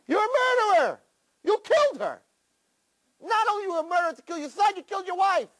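jab the word murderer at him; high accusing Satan. Acted. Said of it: "the Satan came and he said".

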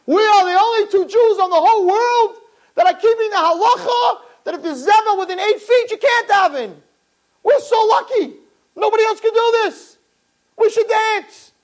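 high, loud, elated; joyful brother. Retold or imitated. Brother rejoicing in the cell, high and elated. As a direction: burst with joy at the luck. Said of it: "and said".

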